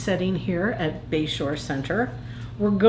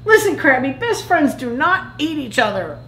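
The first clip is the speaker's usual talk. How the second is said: higher, insistent telling-off; mild scolding; 5 semitones higher than usual. Acted. Plankton lectures Crabby about best friends, mild scolding. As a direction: lay down the rule, lightly indignant.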